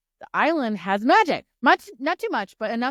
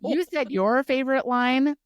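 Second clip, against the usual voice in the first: ordinary talk, nothing marked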